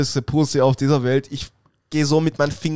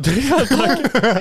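slow, higher, louder laughing echo; 8 semitones higher, 5 dB louder, much slower than usual. Real, not acted. Echoing his friend's crocodile joke, laughing and drawn out. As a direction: stretch the word out through laughter.

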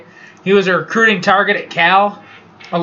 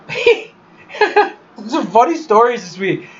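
real laughter bubbling through the words; cracking up; 3 semitones higher than usual. Real, not acted. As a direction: lose it laughing mid-story. Cracks up telling a funny story.

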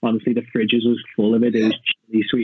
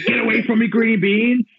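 hammed-up, high-pitched chef's outburst; mock aggressive; 12 semitones higher than usual. Joking cook fending people off the food, mock aggressive. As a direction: scold the food in a high, jokey voice. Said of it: "I get aggressive".

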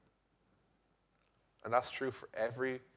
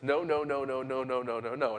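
run-together string of refusals, mildly acted; imitated toddler refusal. Daughter refusing her father after a nap, imitated toddler refusal. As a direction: mimic a child freaking out, only lightly. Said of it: "she's freaking out at me".

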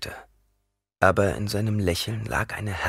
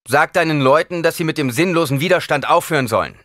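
higher, louder firm command; commanding order; 9 semitones higher, 7 dB louder than usual. A narrator's reading. Paul orders Gurney to call off his men, commanding. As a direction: order him to stop the fight.

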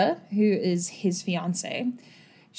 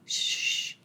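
a soft, unvoiced shush; 8 dB quieter than usual. Mayor hushing his frightened fiancée, a soft shush. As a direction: hush her gently with a shh. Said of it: "he shushes her".

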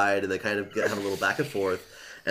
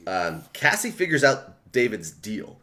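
ordinary talk, nothing marked

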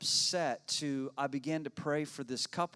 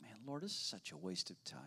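a frustrated prayer under the breath; whispered complaint; 10 dB quieter than usual. Retold or imitated. Pastor complaining to God in the waiting room, whispered. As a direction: whisper it, fed up and impatient.

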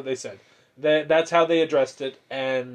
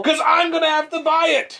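high, loud exasperated parent; re-enacted scolding, voice pitched way up; yelling at kids; 13 semitones higher, 5 dB louder than usual. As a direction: yell the reason at the kids. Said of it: "I yell at my kids".

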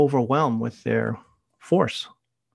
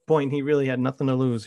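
normal talking voice

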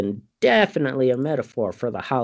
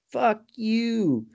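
high drawn-out curse; 9 semitones higher than usual. Retold or imitated. Acted curse in retelling.

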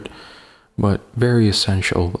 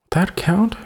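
high squeaky voice; 11 semitones higher than usual. Pretend. Mock question in high voice.

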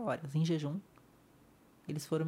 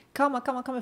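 higher, louder rushed calma calma; urgent friend calming; 8 semitones higher, 5 dB louder than usual. Retold or imitated. Friend rushing to her side, high urgent calma. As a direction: friend rushing over, urging calm. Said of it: "ela já correu".

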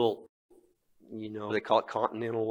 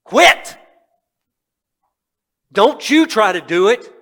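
high, loud stern command; commanding shout; 9 semitones higher, 13 dB louder, slower than usual. Retold or imitated. God, voiced by the preacher, ordering him to stop, loud stern shout. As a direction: bark the order from above. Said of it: "I heard God saying real loud and clear".

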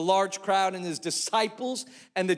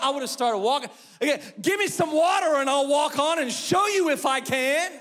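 indignant mock outrage; 7 semitones higher than usual. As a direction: play it offended, cocky. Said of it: "I would have been like".

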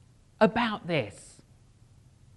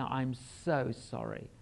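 softer, slower sorry; quiet, sincere apology; gentle apology; 8 semitones lower, 9 dB quieter, slower than usual. Retold or imitated. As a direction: soothe her softly.

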